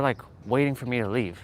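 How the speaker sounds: ordinary delivery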